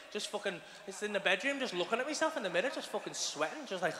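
ordinary talk, nothing marked

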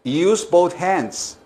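"Use both hands" muy despacio y marcado; dictado lento. Shown as a demonstration. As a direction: modela cada palabra. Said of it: "lección de inglés dictada". (dictado muy lento)

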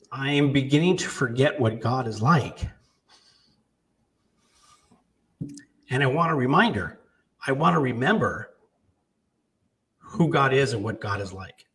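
fighting back tears as you quote her; a touch of real tears in the voice; tearful quote. Real, not acted. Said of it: "Sorry for getting emotional".

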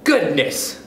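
mildly disgusted exclamation. A narrator's reading. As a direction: show a touch of dismay. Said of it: "said Stuart in disgust".

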